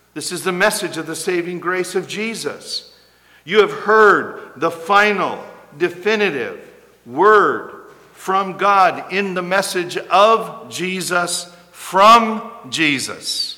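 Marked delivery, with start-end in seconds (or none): 3.5-13.5 loud, slow, deliberate proclamation; forceful preaching. Acted. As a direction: spell out each word from the pulpit.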